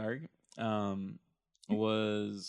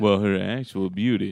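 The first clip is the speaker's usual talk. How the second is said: lower, louder lounge-singer croon; creepy lounge singer; 4 semitones lower, 7 dB louder than usual. Shown as a demonstration. Redoing the Plutarch quote as a joke, creepy lounge singer. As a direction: croon it like a sleazy lounge act. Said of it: "like, a creepy, like, lounge singer guy".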